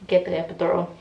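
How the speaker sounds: ordinary talk, nothing marked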